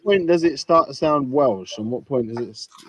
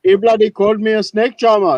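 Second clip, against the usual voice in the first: higher, mock-indignant Indian accent; 6 semitones higher than usual. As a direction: complain in a put-on Indian accent, pitch raised. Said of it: "Indian and a Welsh guy having an argument".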